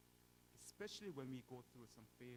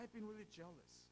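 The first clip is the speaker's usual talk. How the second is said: whining Elijah; 8 semitones higher than usual. Retold or imitated. Elijah whines to God about being the only faithful one. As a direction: whine like a prophet in a tantrum. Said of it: "he's whining".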